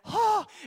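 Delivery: high, sharp theatrical gasp; sudden voiced intake of breath; overwhelmed gasp. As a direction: gasp as if starstruck. Acting out being overwhelmed by her attention.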